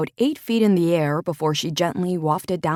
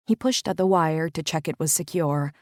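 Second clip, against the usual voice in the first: ordinary delivery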